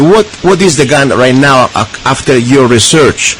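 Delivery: usual speaking voice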